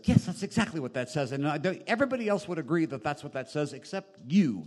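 mock argument. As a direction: imitate a spouse insisting on being right. Acts out marital argument over a text.

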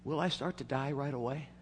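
put-on shaky, nervous little boy asking; trembling voice. A narrator's reading. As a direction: quaver it like a scared child. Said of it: "he says in a trembling voice".

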